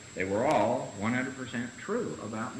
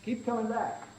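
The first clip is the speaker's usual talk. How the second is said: much higher, sing-song imitation of a cheery slogan; mock slogan; 11 semitones higher than usual. Speaker mocking what members tell newcomers, sing-song. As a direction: toss it off like a bumper sticker.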